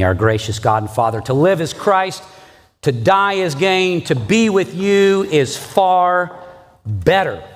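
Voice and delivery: earnest prayer lifting toward heaven; pastor's prayer. Acted. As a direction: pray it over the congregation. Pastor leads closing prayer.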